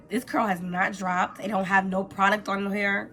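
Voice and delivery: ordinary delivery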